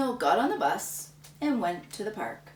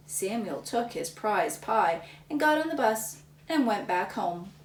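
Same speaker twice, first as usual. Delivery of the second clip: ordinary delivery, nothing marked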